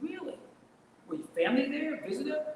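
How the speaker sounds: high-pitched quoted questions, mildly astonished; retold surprise. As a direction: act out someone's surprised questions, pitch jumping up. Retelling a surprised conversation.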